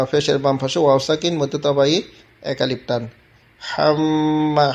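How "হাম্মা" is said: হাম্মা spoken slowly, the long a held; stretched demo word. Shown as a demonstration. Teacher stretching হাম্মা slowly for a student. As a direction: draw out হাম্মা plainly. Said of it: "হাম্মা এক আলিফ টেনে পড়তে হবে".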